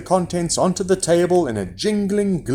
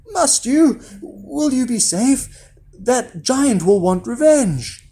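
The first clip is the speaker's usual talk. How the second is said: high, anxious mother voice; mother pleading caution, much higher; worried mother; 10 semitones higher, slower than usual. A narrator's reading. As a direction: fret over your son, pitched high. Mother voiced worrying about Jack.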